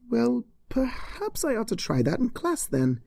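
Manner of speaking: worried teacher checking on her; Cheerilee's voice. Acted.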